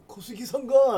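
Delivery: 小杉さんが with rising end; uptalk. Shown as a demonstration. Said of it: "ってなるの？".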